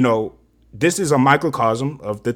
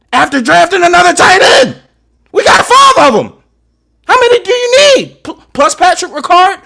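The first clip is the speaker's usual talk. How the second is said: real high-pitched, shouted outrage; exasperated rant; 9 dB louder than usual. Real, not acted. Fan ranting about too many tight ends, shouting in exasperation. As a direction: yell it at full pitch, incredulous.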